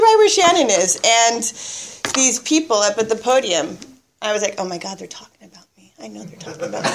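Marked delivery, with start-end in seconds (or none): 4.6-6.9 very soft, worried inner voice; retold private worry, under the breath; hushed paranoid thought. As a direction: mutter it to yourself, mock-paranoid. Retold private panicky thought at meeting.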